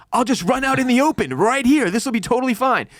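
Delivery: high-pitched, mock-oblivious voice; high, silly parody of blithe optimism; mocking naive confidence. Mocking people who ran out.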